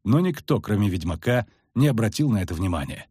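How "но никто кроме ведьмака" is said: usual speaking voice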